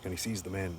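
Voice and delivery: normal talking voice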